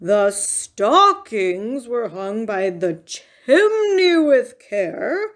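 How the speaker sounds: very slow, forceful recitation. A narrator's reading. Bear repeats the line at mournful Mouse, loud and insistent. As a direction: recite it loudly, stretching the words pointedly. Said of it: "Bear repeated more loudly".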